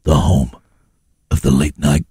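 very low American station-ident voice; mock late-night radio DJ. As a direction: intone it deep, pausing between phrases.

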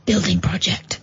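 whispering. Acted. Spooky aside.

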